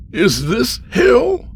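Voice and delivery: high, slow, alarmed question; frightened cry. Acted. Stranded Andy asks the old man where he is, frightened cry.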